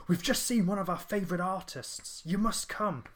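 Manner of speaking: high, eager rush to fetch a friend; excited friends. Retold or imitated. Friends rushing in to fetch him, excited. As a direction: pull your mate over, buzzing. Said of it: "really excited".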